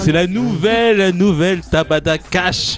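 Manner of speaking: high, slow, laughing shout; hype shout. Acted. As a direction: build her up like a star. Hyping the sexy co-host.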